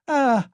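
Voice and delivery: sudden high shrieking "Ah!"; a wife's shocked scream at the sight; frenzied cry. A narrator's reading. Wife sees husband kneeling, frenzied shriek. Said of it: "she cried out in a frenzy".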